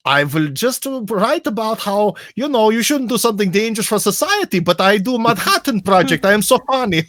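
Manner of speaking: high, put-on German accent mocking Einstein. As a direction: play a hammy German-accented Einstein being smug. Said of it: "Do that racist accent again".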